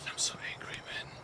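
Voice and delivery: a breathy whisper of pent-up anger. Real, not acted. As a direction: whisper it through your teeth, seething. Whispering his frustration to himself.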